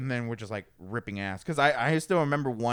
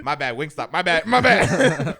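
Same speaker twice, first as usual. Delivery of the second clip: laughing, getting louder each time; laughing apology; 2 semitones higher, 9 dB louder, slower than usual. Retold or imitated. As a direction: apologize while cracking up, building. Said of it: "I was like".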